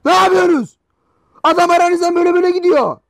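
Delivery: high, loud angry yell; angry shout. Real, not acted. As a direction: bark it at the player on screen. Yelling at player on screen. (yüksek sesle öfkeyle bağırarak)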